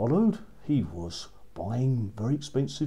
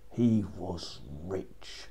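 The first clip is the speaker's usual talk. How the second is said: plain reading voice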